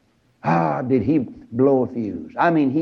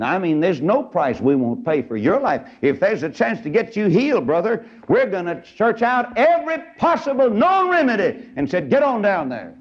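rising, high, urgent royal voice; pushing him to go, pitch climbing; excited king; 9 semitones higher than usual. Acted. King urging a sick general.

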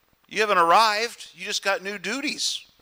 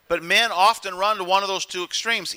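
usual speaking voice

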